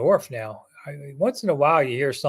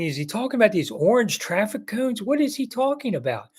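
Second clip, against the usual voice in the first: higher, bewildered asking himself; puzzled thought; 7 semitones higher than usual. Retold or imitated. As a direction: wonder aloud what on earth he means. Puzzled inner thought, retold.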